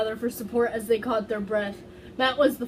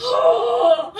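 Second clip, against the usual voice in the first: sharp high delighted gasp; loud excited gasp; 12 semitones higher, 9 dB louder than usual. Real, not acted. Hearing a ridiculous fanfic tag, an excited gasp.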